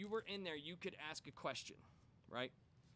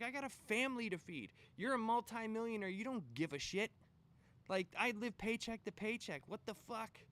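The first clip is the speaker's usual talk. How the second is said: higher, louder outraged protest; angry worker; 6 semitones higher, 3 dB louder than usual. Retold or imitated. Worker shouting at the millionaire CEO, outraged. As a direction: let him have it.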